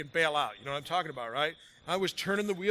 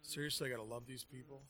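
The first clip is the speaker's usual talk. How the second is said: muttered exasperation; 6 semitones lower, 14 dB quieter than usual. Retold or imitated. Exasperated inner thought.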